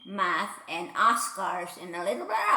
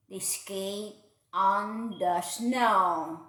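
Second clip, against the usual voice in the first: ordinary delivery, nothing marked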